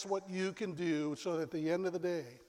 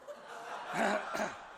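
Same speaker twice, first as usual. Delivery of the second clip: embarrassed laugh; 4 dB quieter than usual. Acted. After wrong-number realization.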